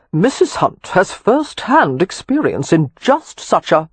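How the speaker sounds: slow, lazy teasing drawl. A narrator's reading. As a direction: drawl it out lazily. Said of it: "came Mister Hunt's lazy drawl".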